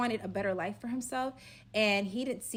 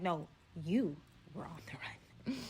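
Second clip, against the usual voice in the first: whispered retort; 4 semitones lower, 5 dB quieter than usual. Retold or imitated. Correcting the robber.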